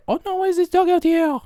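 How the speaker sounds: very high-pitched fretting voice; mock-concerned bystander falsetto; worried onlookers. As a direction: put on a high voice of alarmed passers-by. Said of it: "then people say".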